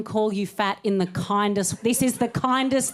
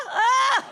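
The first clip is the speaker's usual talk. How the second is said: delighted cry; 13 semitones higher, 3 dB louder than usual. Acted. Delight at sweating.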